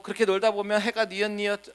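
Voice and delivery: ordinary delivery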